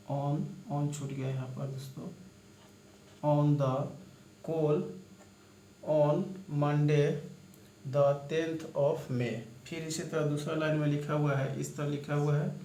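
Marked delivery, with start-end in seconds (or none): 3.2-9.3 slow dictation. Shown as a demonstration. Reading the shorthand sentence aloud.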